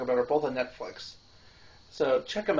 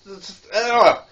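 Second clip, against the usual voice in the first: disgusted ugh; 5 semitones higher, 10 dB louder, slower than usual. Retold or imitated. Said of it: "make these weird-ass noises".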